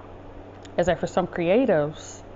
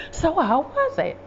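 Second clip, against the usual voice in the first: high, drawn-out eager question; slow, pitched-up happy curiosity; happy, expectant mother; 9 semitones higher than usual. Retold or imitated. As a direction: imitate a parent keen to hear it all. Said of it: "She'd be so happy".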